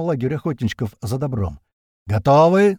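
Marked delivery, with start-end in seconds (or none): 2.1-2.8 shout. A narrator's reading. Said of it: "мне на ухо орёт мамуля".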